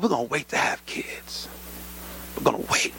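whispered resolve to wait; hushed whisper. Retold or imitated. Husband whispering to wife about waiting for kids, hushed. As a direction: keep it to a private whisper. Couple privately deciding to wait.

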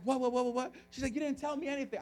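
mock bewildered objection; flustered protest. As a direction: act out a wife caught off guard. Wife caught off guard, retold.

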